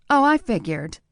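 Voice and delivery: voiced woman, teasing; wry woman. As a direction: toss it off like a smart mouth. Nadia's smart-mouthed reply.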